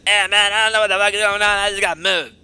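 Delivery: hammed-up loud protest; Scully. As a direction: shout back a defensive excuse in character. Voices Scully answering the accusation.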